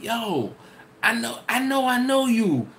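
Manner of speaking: lightly voiced fan who knows him; excited follower.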